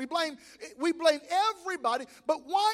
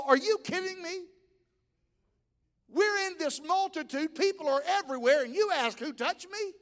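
acting out the fed-up disciples; exasperated disciples; 3 semitones higher than usual. Disciples to Jesus in the crowd, incredulous and exasperated. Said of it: "they go".

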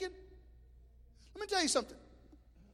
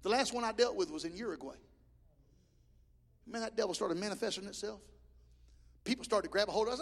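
ordinary talk, nothing marked

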